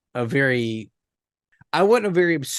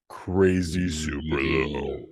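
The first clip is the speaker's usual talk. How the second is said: deep gas voice; 9 semitones lower, 4 dB quieter, slower than usual. Shown as a demonstration. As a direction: voice dropped way down, slow. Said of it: "sulfur hexafluoride makes your voice".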